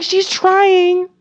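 high, loud mocking voice; mock voter voice. Mocking what voters said about Betty Ford, high and loud. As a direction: sneer it like a snide critic.

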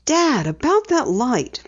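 thoughtful. A narrator's reading. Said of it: "Penny said thoughtfully".

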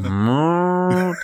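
high mooing cow voice. Retold or imitated. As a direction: moo it like a cow. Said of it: "And the cow went".

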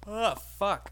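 words stretched through a yawn. Real, not acted. Mid-yawn, telling podcast listeners what he was doing. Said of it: "That was me yawning".

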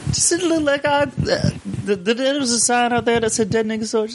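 high-pitched movie-quote imitation; imitated film character. Friend quoting a film scene, high put-on character voice. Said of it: "Quentin Tarantino's a dick".

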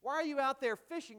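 hammed-up scolding question; mock rebuke. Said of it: "He doesn't say to them, rebuke".

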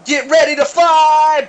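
high, slow, big hype shout; hype intro shout. Pretend. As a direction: roar it like an arena intro. That was a pretty good intro.

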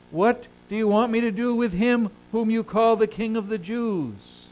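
declaimed, exasperated ruler's question; Pilate questioning the crowd. Acted. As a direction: put the question loudly and high. Reads Pilate's question to the crowd aloud.

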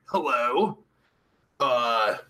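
ordinary delivery

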